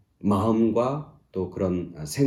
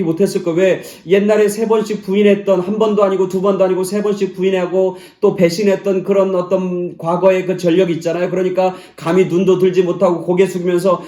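loud, high, driving sermon voice; fervent preaching; 11 semitones higher, 14 dB louder than usual. Acted. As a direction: preach it out to the whole church. Loud preaching at full force.

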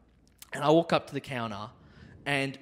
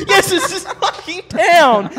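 high, loud, slow and laughing; laughing loud mock exclamation; 12 dB louder, slower than usual. Laughing at his mate, loud and slow. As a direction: burst out laughing as you declare it. Mocking the town name, laughing.

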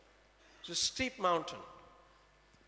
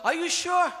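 frightened question; 8 semitones higher, 7 dB louder than usual. Acted. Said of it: "he looks up".

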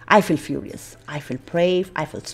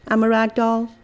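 playful rag doll; 5 semitones higher, 7 dB louder than usual. Pretend. Acting as a floppy rag doll.

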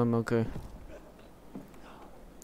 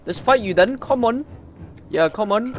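taunting the enemy; 10 semitones higher, 5 dB louder than usual. Real, not acted. Taunting boss in a fight.

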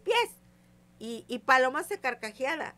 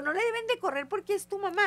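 high, loud reproach; scolding; 9 semitones higher, 4 dB louder than usual. Retold or imitated. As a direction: scold the girls for running from their mother. Regaña a las hijas. (regaño agudo y fuerte)

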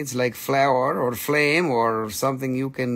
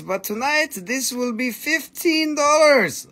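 excited; 10 semitones higher than usual. Real, not acted. Gleeful price drop announcement.

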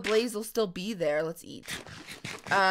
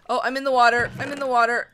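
loud, slightly panicked blurting; a touch of real panic; mild panic; 8 dB louder, much faster than usual. Real, not acted. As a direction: blurt it out in alarm. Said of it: "I don't know why I'm panicking".

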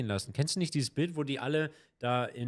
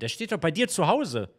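ordinary talk

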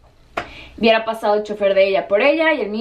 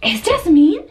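higher-pitched incredulous question; acted surprise; 7 semitones higher, 3 dB louder, much slower than usual. Acting out spotting Jasmine at the airport, high surprised question. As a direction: re-enact astonished recognition. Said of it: "hasta se los voy a actuar".

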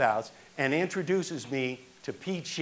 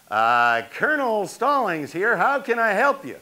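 a touch higher and louder, clipped phone greeting; brusque official; 7 semitones higher, 6 dB louder than usual. Retold or imitated. Colonel answering the Yankees phone, mild officious tone.

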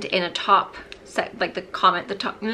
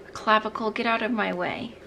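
usual speaking voice

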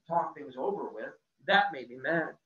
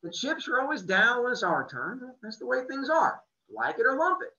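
higher, easygoing, louder; good-natured shrug; 9 semitones higher, 6 dB louder than usual. A narrator's reading. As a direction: say it like a grin. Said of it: "with a good-natured grin".

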